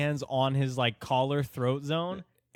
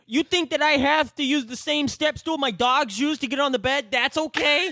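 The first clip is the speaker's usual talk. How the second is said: much higher, louder outraged parody, laughing; mock ranting short man; 7 dB louder than usual. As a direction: yell the indignant question like the tiny furious man.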